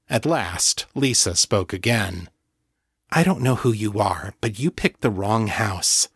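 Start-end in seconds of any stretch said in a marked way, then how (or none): none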